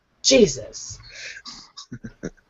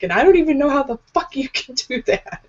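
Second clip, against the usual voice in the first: high-pitched retold outburst; exasperated rant, voice jumping up; losing my mind; 7 semitones higher than usual. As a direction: re-enact cracking up in disbelief. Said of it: "I finally fucking just lose my mind".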